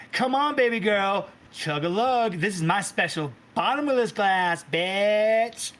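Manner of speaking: high, loud party coaxing; big sister pushing a drink on her sibling; sassy sister. Acted. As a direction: egg her on to chug. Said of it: "and she's like".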